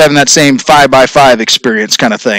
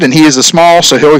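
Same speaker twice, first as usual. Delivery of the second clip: usual speaking voice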